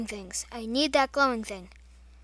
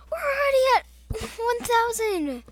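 much higher, loud thrill; excited shout; 10 semitones higher, 5 dB louder than usual. Real, not acted. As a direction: shout it with glee. Excited at score.

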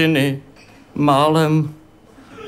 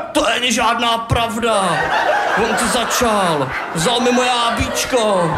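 raised protesting voice; telling the mother off, indignant and higher; indignant self-defence; 6 semitones higher than usual. Acted. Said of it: "A já jsem se bránil".

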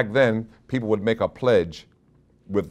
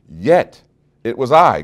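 God's booming voice; 4 semitones higher, 10 dB louder, slower than usual. Acted. God's words preached aloud.

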